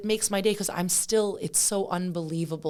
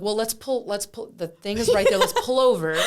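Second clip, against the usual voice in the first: keyed-up, laughing rush to get him to pull over; a giddy push to stop the car; eager plea; 4 semitones higher, 6 dB louder than usual. Retold or imitated. To the driver, mock urgent and laughing.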